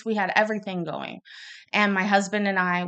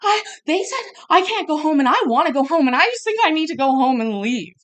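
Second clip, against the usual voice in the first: much higher, louder, whining plea; losing it; 9 semitones higher, 7 dB louder than usual. Retold or imitated. Exhausted mother pleading with her doctor to go home, high whine. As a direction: beg like you're at the end of your rope. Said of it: "I was, like, losing it".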